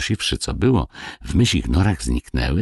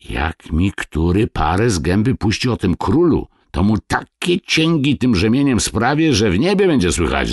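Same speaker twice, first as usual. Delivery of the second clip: put-on raised-pitch father warning his boys; stern threat; 6 semitones higher, 3 dB louder than usual. A narrator's reading. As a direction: build into a storyteller's threatening scold. (surowa groźba ojca)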